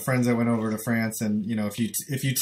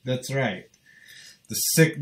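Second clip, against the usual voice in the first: usual speaking voice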